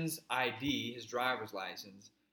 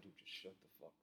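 hushed warning; 6 semitones lower, 21 dB quieter, faster than usual. Retold or imitated. Hushing friend during cop search.

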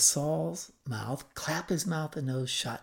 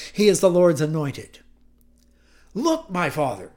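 raised voice, impassioned appeal; loud calling out; 4 semitones higher, 7 dB louder than usual. Acted. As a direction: plead your innocence loudly to a king. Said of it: "called out in a loud voice".

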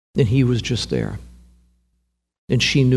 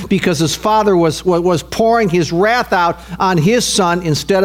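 impassioned preaching; 7 semitones higher than usual. Real, not acted. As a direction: preach it hard, pitch climbing on feeling.

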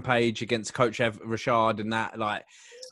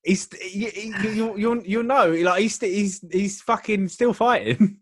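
excited teasing hint; 9 semitones higher, slower than usual. Real, not acted. Excited hint to guess fighter.

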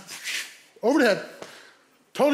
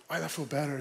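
soft, low acceptance; subdued relief; 5 semitones lower, 10 dB quieter, faster than usual. Retold or imitated. Driver reassured by the cop, quiet relief. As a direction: settle down and say it small.

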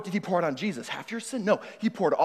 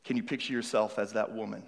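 guided imagining. Acted.

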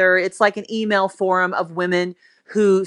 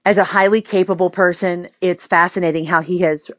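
usual speaking voice

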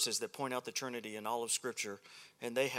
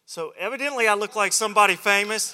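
loud; 8 semitones higher, 13 dB louder than usual. Real, not acted. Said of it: "slide reveal joke".

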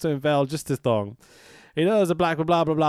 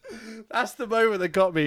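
ordinary delivery

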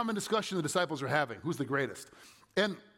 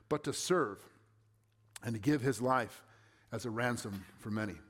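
measured, lower scripture reading voice; 4 semitones lower than usual. Acted. Pastor reading the Gospel to the congregation, measured and low. Reads the Gospel passage aloud.

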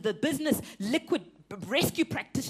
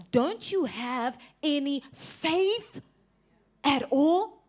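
slow question; 3 semitones higher, much slower than usual. Acted.

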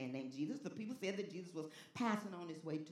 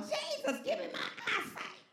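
very high, squeezed mock demand; mock cry out. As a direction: parody a demanding plea up high. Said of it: "didn't cry out".

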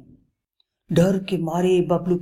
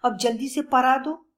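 higher, pleading eagerness; eagerly; 9 semitones higher than usual. Acted. Lion begs Bablu to teach him quickly. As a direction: hurry him along, can't wait. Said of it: "शेर ने उत्सुकता से कहा".

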